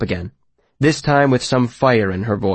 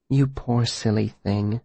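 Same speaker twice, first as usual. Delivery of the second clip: soft and slow, gently; 6 dB quieter, slower than usual. A narrator's reading. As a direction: murmur it softly and slowly. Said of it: "Denna said softly".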